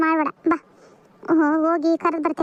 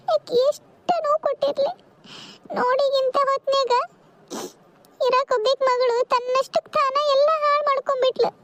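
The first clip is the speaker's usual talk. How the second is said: higher, tearful voice breaking; mother weeping over her lost daughter, higher and tearful; crying; 7 semitones higher than usual. Acted. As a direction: speak through tears, pitch lifted. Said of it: "ಯಾಕ್ ಅಳಕತ್ತೀರಿ?".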